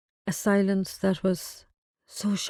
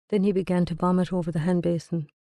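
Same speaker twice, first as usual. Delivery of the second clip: usual speaking voice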